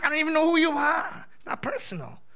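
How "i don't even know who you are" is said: higher, mock-cold brush-off; pretending to snub a stranger; cold dismissive. Shown as a demonstration. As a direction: say I don't even know who you are as a snubbing put-down. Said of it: "Not like".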